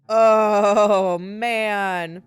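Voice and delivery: ordinary talk, nothing marked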